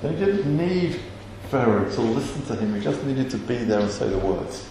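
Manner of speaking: low, softened, deliberate sermon delivery; solemn preaching. Real, not acted. Preacher telling how neither man stopped, hushed and solemn. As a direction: let each phrase land with quiet conviction.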